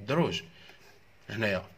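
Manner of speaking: ordinary talk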